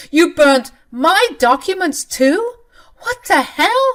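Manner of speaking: high, loud, slow disbelieving shout; shocked yell. Acted. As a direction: shriek it as it sinks in. Husband learns documents burned.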